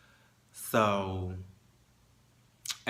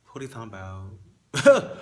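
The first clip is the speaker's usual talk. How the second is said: inner retort, louder; 2 semitones higher, 11 dB louder than usual. Retold or imitated. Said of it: "I'm thinking in my head".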